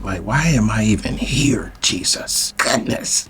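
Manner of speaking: whispered lament to himself; exasperated whisper. Retold or imitated. Man regretting the situation during the phone call, whispered exasperation. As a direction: whisper it as a private, regretful thought.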